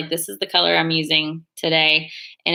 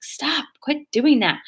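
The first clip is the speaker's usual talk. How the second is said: higher-pitched mock telling-off, drawn out; retold exasperated scolding; 8 semitones higher, slower than usual. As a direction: scold a child without raising your volume.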